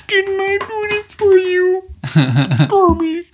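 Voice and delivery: very high, slow falsetto wail; Miss Piggy. Acted. Performing the viral song, high wailing diva. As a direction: draw it out in a squeaky puppet falsetto. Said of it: "do Miss Piggy".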